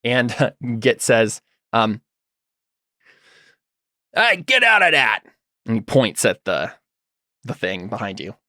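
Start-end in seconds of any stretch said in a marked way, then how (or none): 4.1-5.2 high, put-on scolding voice; raised-pitch telling-off; Git's scolding voice. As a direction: shoo it off in a higher character voice. Game master voices Git shooing creature.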